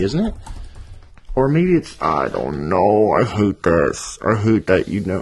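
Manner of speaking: usual speaking voice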